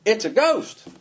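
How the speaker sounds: high, mock-terrified shriek of Ah; hammed-up fright, disciples seeing a ghost; mock ghost scare. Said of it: "and cried out. They went".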